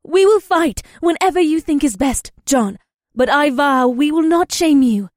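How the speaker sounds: put-on high, loud determined young woman; eager determination. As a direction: vow it with eager resolve. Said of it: "her eager determination returned".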